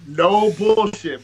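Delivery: loud, drawn-out 'no bullshit'; emphatic insistence. Real, not acted. Storyteller swearing to his amazed listener it is true, emphatic. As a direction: stretch it out loud and high.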